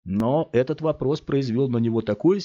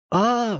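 cry of pain; 13 semitones higher, 3 dB louder than usual. A narrator's reading. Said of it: "вскрикнул Скотт".

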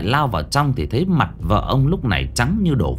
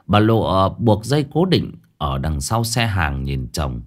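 ordinary delivery, nothing marked

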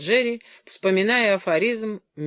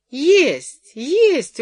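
high, loud insistence; high-pitched, pressing appeal; urgent persuading; 10 semitones higher, 8 dB louder than usual. A narrator's reading. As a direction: insist emphatically, each word leaping up. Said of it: "уговаривала Джоан".

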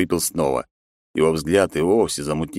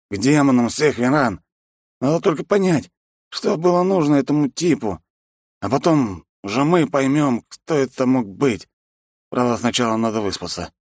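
put-on drunk determination; 7 semitones higher than usual. Drunk man plans.

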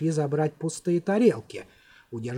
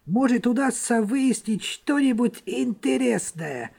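higher, sly soldier voice; eager young soldier; 5 semitones higher than usual. A narrator's reading. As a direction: pitch the idea slyly. Murgen proposes a plan.

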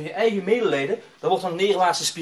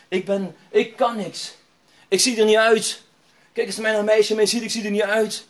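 raised-voice retelling of self-loathing; imitated sobbing despair; 5 semitones higher, 5 dB louder than usual. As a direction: act out a young man's despair, without real sobs. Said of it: "hij was echt een snikken".

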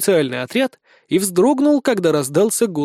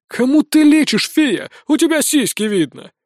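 scoffing disbelief; 7 semitones higher than usual. Acted. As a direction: push back hard, incredulous colonel. Colonel scoffs at the boy's claim. (недоверчивый наезд)

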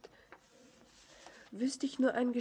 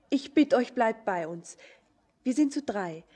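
ordinary talk, nothing marked

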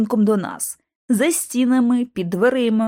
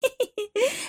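soft, high little titter; snickering giggle; 13 semitones higher, 6 dB quieter than usual. A narrator's reading. Bobby Vernon mocks the rival agents, snickering giggle. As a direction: giggle under the breath, high and quiet. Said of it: "Бобі Вернон захихотів".

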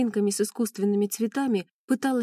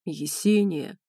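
lower, deep man's call; booming bass; 4 semitones lower, much slower than usual. A narrator's reading. Ренат calls Есения through the door, deep bass call. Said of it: "басил Ренат".

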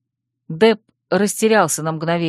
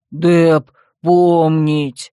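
slow, loud, heavy brute voice; primitive brute; 5 dB louder, much slower than usual. A narrator's reading. Dep jabs a youth in the chest, brutish drawl. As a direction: drag each word out like a brute. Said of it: "осклабился".

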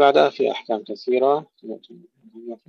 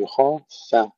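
ordinary delivery

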